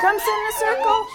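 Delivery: high, fast sing-song call. Real, not acted. Calling children to circle.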